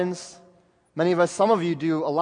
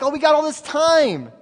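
careless attitude; 7 semitones higher, 4 dB louder than usual. Retold or imitated. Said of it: "mindset like".

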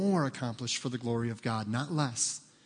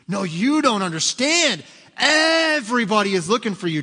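high, loud, urgent panic; frantic Simon; 7 semitones higher, 7 dB louder than usual. Acted. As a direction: blurt it out like everything's on fire. Panicked disciple tells Jesus.

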